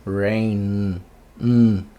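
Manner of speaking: rain, then the N hummed alone; som do N. Shown as a demonstration. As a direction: isolate the final n of rain. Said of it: "Como que é o som do N?". (rain destacando o n final)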